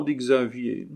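ordinary delivery, nothing marked

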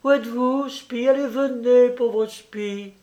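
high, sing-song coaxing call. A narrator's reading. Juliette calling her dog from the bedroom, high coaxing call. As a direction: lure the pet out sweetly. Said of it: "d'une voix calmine".

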